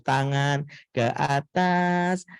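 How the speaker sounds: put-on slow, bright sing-song instructions to small kids; kindergarten teacher. Shown as a demonstration. Preacher demoing how to talk to kindergarteners, slow sing-song. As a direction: lead a TK class clapping game, slowly. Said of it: "pakai gerakan tepuk-tepuk".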